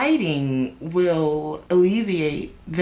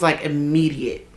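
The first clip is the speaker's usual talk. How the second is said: usual speaking voice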